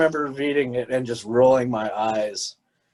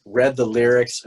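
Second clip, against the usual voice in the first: usual speaking voice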